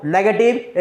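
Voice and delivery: ordinary talk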